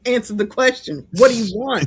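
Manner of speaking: hammed-up hostile questioning; mock interrogation. Co-host joins in grilling the woman, mock insistent. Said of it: "No one interrogated you before?".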